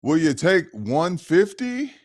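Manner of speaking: high-pitched lowballing buyer; pushy buyer voice. Retold or imitated. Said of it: "I hate Marketplace".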